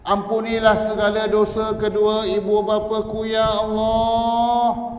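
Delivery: high, loud begging cry, last words drawn out; wailing plea. Shown as a demonstration. Preacher shows the congregation how to pray for parents, wailing plea. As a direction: lament for the parents at full voice. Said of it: "Ulang tiga kali".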